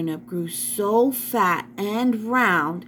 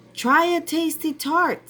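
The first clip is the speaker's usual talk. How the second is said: higher, sugary coaxing voice of the disguised queen; 8 semitones higher than usual. Acted. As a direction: tempt her sweetly, pitched well up. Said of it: "said the queen".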